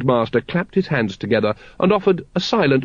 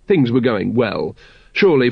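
usual speaking voice

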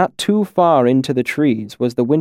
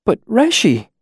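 high tearful plea; pleading; 11 semitones higher than usual. A narrator's reading. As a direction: beg him, near tears. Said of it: "close to tears".

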